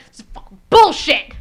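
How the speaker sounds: acted loud, exploding outrage; so much anger. Officer upset that they know each other, acted angry outburst. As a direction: burst out on the last word, high and loud. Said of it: "So much anger".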